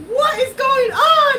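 high, loud and slow alarmed shout. Retold or imitated. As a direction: yell it at a stopped rider. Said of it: "she's like".

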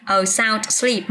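slow, careful reading of a sound sleep; teacher slowly models a sound sleep for the class; slow model phrase. Shown as a demonstration. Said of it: "Có cơ sở tranh luận, ok".

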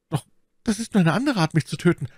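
high, fast frightened protest; protesting bandit. Acted. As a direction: object desperately to your captor.

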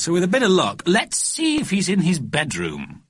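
hopeful mother voice; lady voice. A narrator's reading. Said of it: "said Missus Smythe Fortescue".

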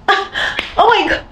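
slow high disbelief; shocked. Retold or imitated. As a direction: gasp it out slowly. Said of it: "I'm like".

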